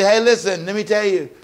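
lightly voiced, coaxing serpent; a touch of a wheedling tempter, quick; sly serpent. Serpent tempting the woman, lightly coaxing. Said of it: "The serpent says basically".

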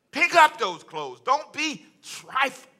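slow, high, emphatic motherly scolding; admonishing mother. Retold or imitated. Mother telling her child to tidy up, admonishing. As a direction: stretch it out like a mother's loud order. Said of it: "She was admonishing me".

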